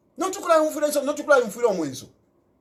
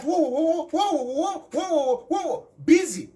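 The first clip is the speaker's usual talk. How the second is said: higher, laughing mock exclamations; mocking whoa; 5 semitones higher, slower than usual. Speaker mocking opposition busy quarreling, higher laughing whoas. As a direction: tease them with a playful whoa whoa.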